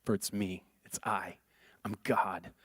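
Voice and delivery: low, soft reassuring voice; calm them like a shepherd; gentle Jesus. Pretend. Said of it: "Those famous words that God passes".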